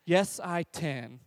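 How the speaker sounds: put-on slow, soft toddler echo; toddler son. Toddler repeats after his dad, slow and soft. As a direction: repeat it like a timid two-year-old. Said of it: "motivational speaker to my two-year-old".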